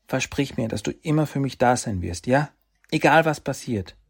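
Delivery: a little brother asking for a promise; young boy pleading. Acted.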